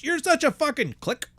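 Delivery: high-pitched mock berating; high mock tirade, cut off mid-sentence; mock wife yelling. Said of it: "He's yelling mid-sentence".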